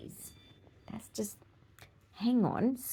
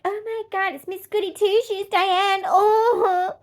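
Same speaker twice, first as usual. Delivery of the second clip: higher, mocking sing-song tease; teasing friends; 9 semitones higher, 4 dB louder than usual. Friends teasing Diane as a goody-two-shoes, in a higher mocking voice. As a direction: rib her like a snarky friend. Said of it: "my friends would be like".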